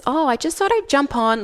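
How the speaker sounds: hammed-up breezy influencer greeting; cliché opener. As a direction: imitate the stock Instagram hello. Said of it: "my brain has programmed that".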